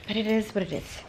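ordinary delivery

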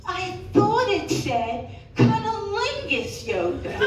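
ordinary talk

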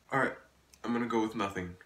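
ordinary talk

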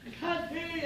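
very high, faint call; small high voice from up above; distant calling back. Acted. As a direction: strain to be heard from far off. Worker calls from far above.

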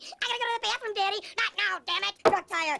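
yelling. Acted. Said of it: "kids are yelling from the back seat".

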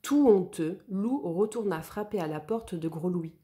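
ordinary delivery

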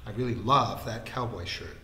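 mock-earnest, with weight on LOVE; teasing copy of a lovelorn avowal; mimicking earnest tone. A narrator's reading. Mimicking Vikram's confession about a shirt, mock earnest. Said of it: "mimicking his tone of voice and the earnest expression".

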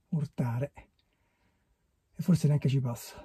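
ordinary talk

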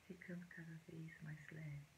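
soft, hushed meditation voice with long pauses; quiet, unhurried relaxation guidance; guided meditation. Acted.

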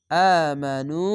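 আমানু intoned with a rising chant; recited word. Shown as a demonstration. Teacher models the finished word, light recitation tone. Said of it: "এক আলিফ টেনে পড়বেন".

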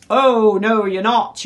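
defiant yell. A narrator's reading. Flynn refuses to be eaten and charges, defiant yell. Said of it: "yelled Captain Flynn".

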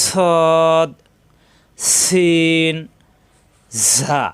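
drawn-out "সদ, সিন, জা" on a steady note; articulation demo. Shown as a demonstration. Teacher models the three whistling letters, drawn out and hissed. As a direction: hiss and hold each of "সদ, সিন, জা". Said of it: "জিহ্বা নিচের দাঁতে চাপ দিয়ে".

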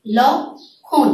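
লক্ষণ said slowly and carefully, sounds drawn out; slow demonstration. Shown as a demonstration. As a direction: spell out লক্ষণ slowly for students. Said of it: "এই শব্দটির উচ্চারণ হয়ে যাচ্ছে". (লক্ষণ ধীরে স্পষ্ট করে উচ্চারণ)

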